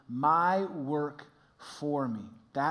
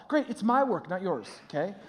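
ordinary talk

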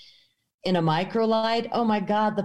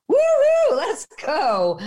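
high, loud whooping cheer; excited whoop; 13 semitones higher, 6 dB louder, slower than usual. Retold or imitated. She cheers herself into the open-door flight, whooping high. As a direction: throw the woo-hoo way up.

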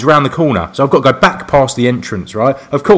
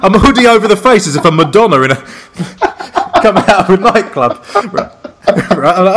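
talking through laughter; can't keep the laugh down; telling the getaway, laughing through it; 5 semitones higher than usual. Real, not acted.

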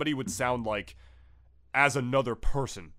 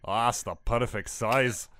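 playful Scottish brogue, slow at the end; lightly done Scottish accent, savouring it. Pretend. Co-host riffing back about keyblades, in a playful Scottish accent. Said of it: "stuff like Scottish".

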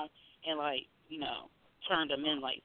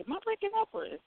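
higher, softer thought aloud; high-pitched quote; 5 dB quieter, much faster than usual. Retold or imitated. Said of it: "I'm like".

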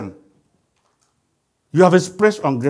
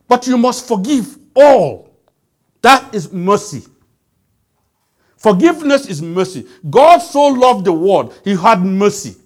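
preaching at full force; 4 semitones higher, 5 dB louder than usual. Acted.

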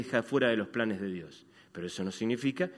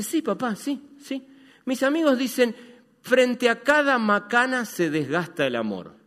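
imitating his daughter; 11 semitones higher, 8 dB louder than usual. Imita a su hija.

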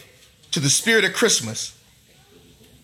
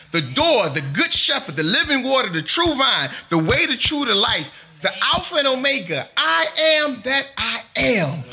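raised, building preacher's roll call of names; rising preaching litany; 4 semitones higher than usual. Acted. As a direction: lift each name higher as the list builds.

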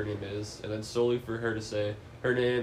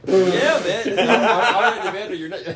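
high, loud, laughing words; 9 semitones higher, 10 dB louder than usual. Real, not acted. Friend teasing about Cody's movie life, laughing. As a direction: crack up while trying to talk.

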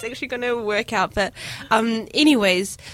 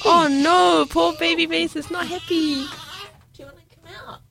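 kissing it better; 7 semitones higher than usual. Real, not acted. Soothing the upset baby.

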